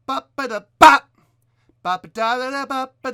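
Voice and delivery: loud, punchy scat with a hard hit on the last "ba"; accented scat demo. Shown as a demonstration. Vocal coach demonstrating the singer's end-of-phrase accents, loud and punchy. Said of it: "that accent on the end of every phrase".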